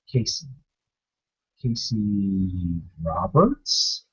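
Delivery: drawn-out, low and soft, puzzled; quietly unsure, slowly recognizing him; slow quizzical recognition. Retold or imitated. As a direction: say the name slowly, like placing a face. Man recognizes him by name.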